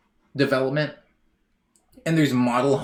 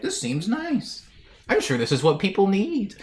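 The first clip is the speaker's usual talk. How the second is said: mock alien voice; 8 semitones higher than usual. Alien touring Malibu, lightly high naive voice. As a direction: sound like a clueless visitor approving.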